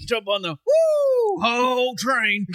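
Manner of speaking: excited shout. Retold or imitated. Friend excitedly shouting a wrestler's name. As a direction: blurt the name out with glee. Ric Flair woo bit.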